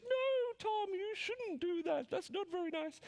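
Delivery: very high, slow and sugary, like a cartoon angel; squeaky high little angel pleading gently; sweet angel voice. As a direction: coo it up high like a goody-goody angel on his shoulder. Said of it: "'Cause it always talks like that".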